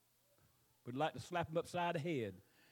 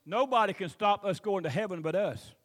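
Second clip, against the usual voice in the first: loud, rising preacher voice; preaching; 2 semitones higher, 7 dB louder than usual. Acted. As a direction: build it up to a cry. Preaching climax.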